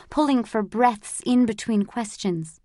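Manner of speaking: usual speaking voice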